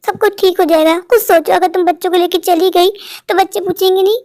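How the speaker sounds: ordinary delivery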